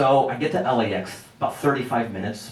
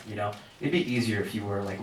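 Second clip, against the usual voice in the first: normal talking voice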